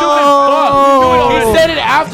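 loud, high, drawn-out insult; heated scolding. Real, not acted. Berating a friend who blurted the quiz answer, heated scolding.

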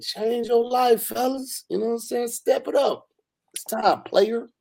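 high-pitched mock pep talk; hammed-up sign-off. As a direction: push the pitch way up for a teasing sign-off.

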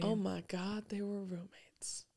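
very soft, slowing to a whisper; hushed meme quote. Retold or imitated. Quoting a Vine to co-host, hushed whisper. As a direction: whisper it like scandalous gossip.

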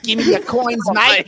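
loud mock Aussie accent. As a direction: bark it in a put-on Aussie accent. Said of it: "they have a weird voice out here".

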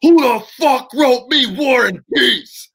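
loud, slow, high mock roar; furious bellow. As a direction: imitate an enraged wrestler bursting in. Big Show bursts in angry.

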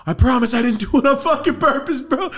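very high and loud, laughing while pleading; laughing plea. Retold or imitated. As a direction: protest innocence through laughter. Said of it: "I felt so like".

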